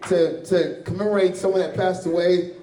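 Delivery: usual speaking voice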